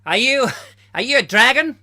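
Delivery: high, loud hammed-up Australian bloke; mock Australian accent. Mocking Pete's Dragon as Australian, hammed-up Australian accent. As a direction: belt it out in an over-the-top Australian accent. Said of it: "the original you told me was Australian".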